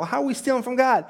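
high-pitched, acted-out disbelief; incredulous protest. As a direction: imitate the accused demanding an explanation. Voicing Israel protesting the accusation.

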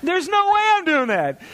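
high, loud retold protest; emphatic refusal.

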